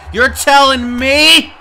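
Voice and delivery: loud, high-pitched indignant challenge; incredulous outrage. Real, not acted. Commentator challenging the new ref over Tristan, incredulous outrage. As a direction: shout it in disbelief.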